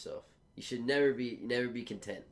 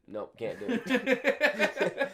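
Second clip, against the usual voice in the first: high, slow, laughing nope; laughing refusal; 11 semitones higher, 3 dB louder, much slower than usual. Acted. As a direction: giggle out the refusal. Mock interrogation answer.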